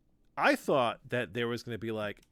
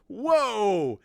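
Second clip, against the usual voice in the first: high, loud drawn whoa; amazed whoa; 9 semitones higher, 8 dB louder than usual. Retold or imitated. Fan reacting to the strange plot, loud amazed whoa. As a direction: react to something bizarre.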